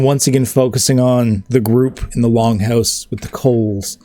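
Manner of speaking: ordinary delivery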